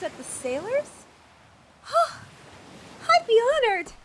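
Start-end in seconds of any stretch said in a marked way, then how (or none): 1.8-3.0 high, sharp delighted gasp; overjoyed intake of breath; excited gasp. Acted. Thrilled by the task. 3.1-3.9 loud, higher eager exclamation; overjoyed acceptance; thrilled. Acted. Acolyte accepts the task eagerly.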